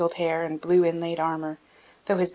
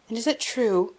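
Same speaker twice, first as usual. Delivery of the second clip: eager cry; 6 semitones higher, 4 dB louder than usual. A narrator's reading. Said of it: "cried Richard".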